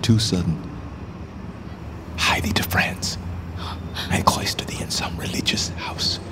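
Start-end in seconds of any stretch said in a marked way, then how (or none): 2.2-6.2 hushed, breath only, pressing her to flee; urgent whisper. Acted. Richard urging his queen away, whispered.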